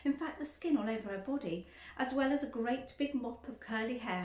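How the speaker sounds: ordinary delivery, nothing marked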